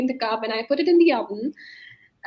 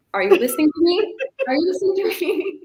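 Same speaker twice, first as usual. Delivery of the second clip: higher, mock-insistent mom question; imitated insistent mom; 6 semitones higher, 4 dB louder than usual. Mom to daughter who isn't listening, imitated insistence. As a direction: put on a mom demanding attention. Mom's exasperated question to distracted child.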